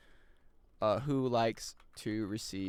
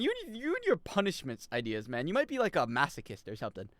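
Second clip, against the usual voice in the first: ordinary talk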